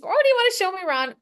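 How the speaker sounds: high, rapid, joking request; playful ha-ha. Retold or imitated. Trainer jokingly asking a new acquaintance for a tour, playful. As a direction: toss it off playfully, quick and high. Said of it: "It truly was kind of a ha ha".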